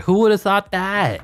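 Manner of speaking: high, playful who-knew; mock surprise. Real, not acted. Commentator marveling at the Lakers going out, mock surprised. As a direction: feign amazement at the upset.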